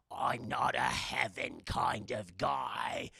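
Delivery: low, slow, grumbling ghost; stubborn old ghost refusing. Retold or imitated. Ghost Bill voice.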